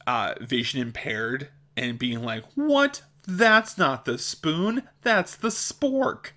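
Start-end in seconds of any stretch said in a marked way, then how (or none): 2.6-6.3 high, incredulous mock scolding; hammed-up correction, drawn out and high; mock correcting. Mimicking someone correcting the vision impaired, mock indignant.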